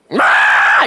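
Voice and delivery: loud squawking rooster cry; rooster scream. Retold or imitated. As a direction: belt out a mock rooster scream at dawn. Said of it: "wakes up in the morning screaming".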